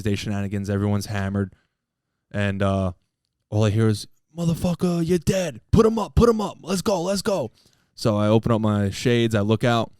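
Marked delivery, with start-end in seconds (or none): 4.4-7.5 put-on high, rowdy drunk calling others out to fight; drunken fight yelling. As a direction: yell it like a drunk picking a fight at 2am. Drunk guys fighting outside at night.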